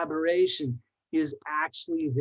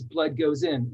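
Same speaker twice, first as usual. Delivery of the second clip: usual speaking voice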